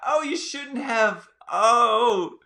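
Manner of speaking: high falsetto gushing, oh you shouldn't have; hammed-up high voice of a charmed woman; mock swooning lady. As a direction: play a flattered lady, way up high and breathless with delight. Acting out the swooning ladies.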